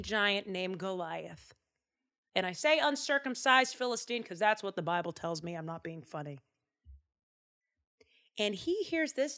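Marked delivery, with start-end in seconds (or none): none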